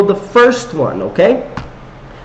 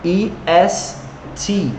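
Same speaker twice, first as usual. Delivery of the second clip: spelled out slowly; 5 dB quieter, slower than usual. Shown as a demonstration. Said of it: "So I only have to put".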